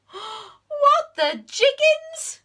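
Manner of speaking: very high, loud, slow shocked cry; the whole class aghast; shocked gasp. Acted. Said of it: "gasped all the little bees".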